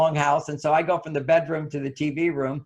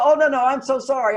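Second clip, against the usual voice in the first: eager apology; 7 semitones higher, 4 dB louder than usual. Retold or imitated. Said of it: "he'll go".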